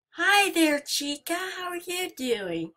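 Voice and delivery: high, sing-song nervous hello; mock-friendly greeting. Real, not acted. Nervously greeting Chica the animatronic, high and sweet. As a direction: greet the creepy robot sweetly to keep calm.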